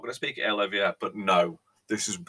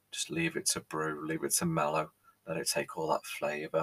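lower, much softer, gently coaxing; soothing hush; 5 semitones lower, 8 dB quieter than usual. Acted. Host coaxing viewers to let the tea brew, soft and soothing. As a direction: murmur it like settling a baby.

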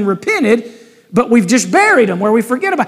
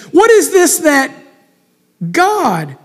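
trembling question; 2 semitones lower, slower than usual. Acted. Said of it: "turned trembling to one another".

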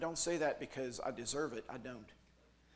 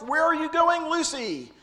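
very high, loud call; mock bystander calling up; calling after him; 15 dB louder than usual. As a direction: shout after him as he flies up.

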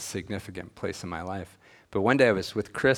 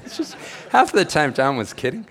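laughing loudly and higher while telling it; 8 semitones higher, 9 dB louder than usual. Real, not acted. Laughing to the congregation about his mentor's teasing, loud and high. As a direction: let the laugh break through the words.

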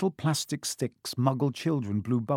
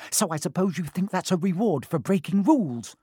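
angry voice; 5 semitones higher than usual. Acted. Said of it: "came an angry voice".

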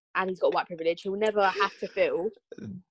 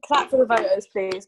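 normal talking voice

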